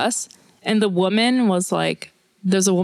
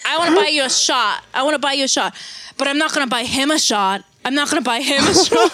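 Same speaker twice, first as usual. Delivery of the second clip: higher-pitched mock tipsy insistence; insistent drunk woman; 5 semitones higher, faster than usual. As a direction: lightly play a drunk fan insisting. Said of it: "she was drunk".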